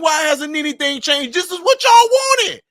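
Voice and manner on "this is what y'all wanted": mock retort. Mock exchange, raised and slowed.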